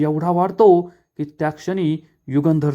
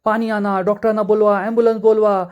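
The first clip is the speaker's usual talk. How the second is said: urgent shouting; 5 semitones higher, faster than usual. A narrator's reading. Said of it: "सगळेजण आरडाओरडा करत असतात".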